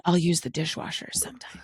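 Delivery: secretive whisper. Real, not acted. Confessing secret behind husband's back.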